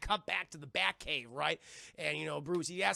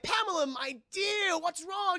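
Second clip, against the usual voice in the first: much higher, louder, slow mock-sweet call; oily villain; 11 semitones higher, 4 dB louder, slower than usual. Woodrue following Pamela, hammed slow mock-sweet call. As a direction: croon it like a creepy suitor. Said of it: "And he's like".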